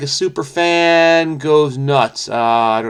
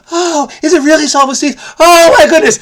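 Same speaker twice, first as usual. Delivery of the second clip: much higher, gushing student voice, louder at the end; excited student; 13 semitones higher, 5 dB louder than usual. Pretend. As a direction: play a starstruck student gasping in delight.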